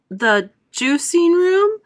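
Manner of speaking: high, slow alarmed question; aghast disbelief; cried out. A narrator's reading. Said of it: "cried Missus Beauregard".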